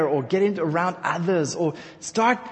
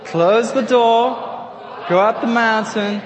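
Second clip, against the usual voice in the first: call-out phrase; 4 semitones higher, 5 dB louder, slower than usual. Shown as a demonstration. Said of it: "Close your eyes and say it".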